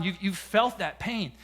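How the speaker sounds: usual speaking voice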